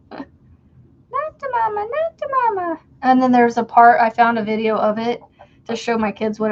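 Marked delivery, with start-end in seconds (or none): 0.1-2.8 very high, slow squeaky cartoon baby. Catchphrase imitation.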